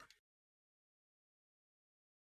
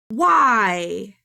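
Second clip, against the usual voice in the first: loud whiny why; 8 dB louder than usual. Retold or imitated. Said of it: "and I'd be like".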